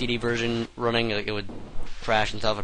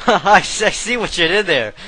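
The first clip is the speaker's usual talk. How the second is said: laughing; 4 semitones higher, 7 dB louder than usual. Real, not acted. Laughing at a game pun.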